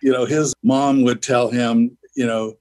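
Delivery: ordinary talk, nothing marked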